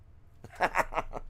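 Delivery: quick airy laugh; short laugh. Real, not acted. Telling the viewers he's no vegetarian, airy chuckle. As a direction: chuckle at your own innuendo.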